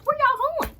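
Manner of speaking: very high, incredulous mock call; squeaky retold disbelief; incredulous question.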